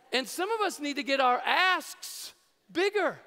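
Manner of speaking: ordinary talk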